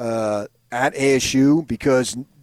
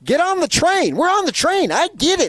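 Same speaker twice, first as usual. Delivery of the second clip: high, loud, hammed-up coach voice; Herm impression; 5 dB louder than usual. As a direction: belt it out like a pumped-up coach. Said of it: "That's my Herm impression".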